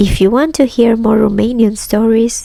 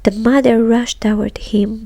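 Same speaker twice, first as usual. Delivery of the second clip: ordinary delivery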